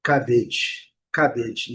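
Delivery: "cabbage" with CAB strong, -idge weak; careful falling "cabbage", stress up front; first syllable stressed. Shown as a demonstration. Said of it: "Primeira sílaba, sílaba forte".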